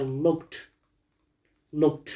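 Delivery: "looked" ending in a crisp T; final T sound. Shown as a demonstration. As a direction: say "looked" as one syllable, lookt.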